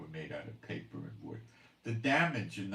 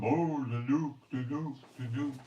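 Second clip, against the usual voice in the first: mocking fearmongers; 6 semitones higher, 4 dB louder than usual. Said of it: "beating the drum".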